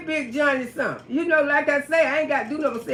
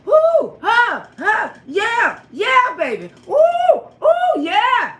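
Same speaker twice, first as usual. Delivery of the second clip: hammed-up loud pleasure moans, higher; mock moaning; 8 semitones higher, 5 dB louder, slower than usual. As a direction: moan it out like a joke love scene. Mock sex noises in story.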